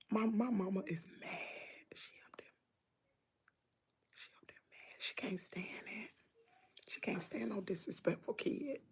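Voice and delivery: hushed whisper so mama won't hear; gossiping in a whisper while mom is upstairs. Real, not acted. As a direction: drop to a secret whisper. Mom upstairs mad, hushing.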